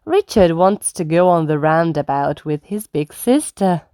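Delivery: ordinary delivery, nothing marked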